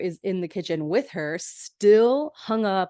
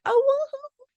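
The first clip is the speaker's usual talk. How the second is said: very high mock woohoo; group cheer; 3 dB louder than usual. Friends teasing the one who must strip, high mock cheering. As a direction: imitate a whole group whooping, high-pitched. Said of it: "they're all like".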